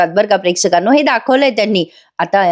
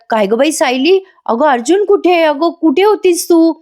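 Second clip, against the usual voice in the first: anxious calling out; 7 semitones higher than usual. Acted. Anxious mother asks about son.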